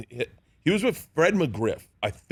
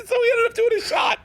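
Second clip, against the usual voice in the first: high, strained punchline through laughter; real laughing shout, much higher. Real, not acted. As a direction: deliver the punchline while cracking up.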